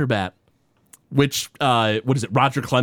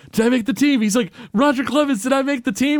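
eager Homer; 8 semitones higher, faster than usual. Retold or imitated. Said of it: "is like".

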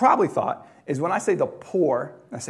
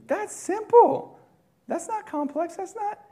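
mock squeak. Mocking the simple plan.

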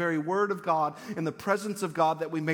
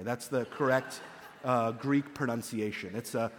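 ordinary talk, nothing marked

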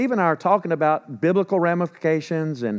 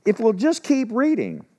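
dry, pointed jab leaning on just; sarcastic nudge, just stressed and falling; mock-patient reminder, If we'll just keep reading; 7 semitones higher than usual. Real, not acted. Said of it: "I'm not gonna be sarcastic".

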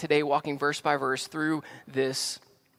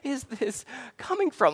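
high, bewildered question; mock bewildered parent; baffled dad; 10 semitones higher than usual. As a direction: sound thrown by the sudden fit. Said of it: "I was like".

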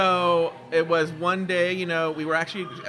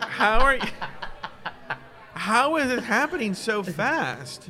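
incredulous quote; 5 semitones higher, slower than usual. Retold or imitated. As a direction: ask it amazed and baffled. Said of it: "You're like".

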